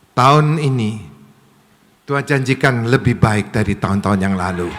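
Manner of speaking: loud, emphatic prophetic declaration; full-force preaching. Real, not acted. Preacher promising abundance to the congregation, loud emphatic preaching. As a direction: proclaim a promise, climbing to a loud call. Proclaims God's promise for year.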